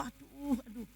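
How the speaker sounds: quiet complaint of pain; retold groaning. Patient moaning after surgery, softly retold groan. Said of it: "Kalau saya ngeluh".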